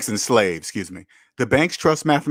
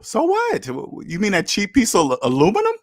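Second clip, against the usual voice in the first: high-pitched scoffing comeback, stammering; mock incredulity; 7 semitones higher than usual. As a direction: act unimpressed by the boast, voice leaping up. Acting out scornful reply to card boast.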